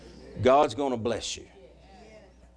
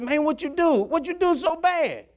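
much higher, urgent asking; alarmed pleading; 14 semitones higher than usual. Retold or imitated. Preacher retells begging his crying friend, higher and alarmed. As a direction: press the friend to tell what he did.

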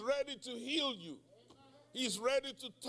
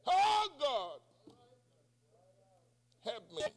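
cry for help; 4 semitones higher, 4 dB louder than usual. Acted. Preacher acts out Peter's cry.

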